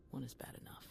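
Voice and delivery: soft, breathy self-deprecating mutter; bitter quiet mutter; muttered aside. Acted. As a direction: say it under your breath.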